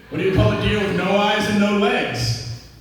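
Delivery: ordinary talk, nothing marked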